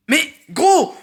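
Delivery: high, loud cry of protest; exasperated outburst. Real, not acted. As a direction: burst out in disbelief. Exasperated at the game's writing. (cri aigu d'exaspération)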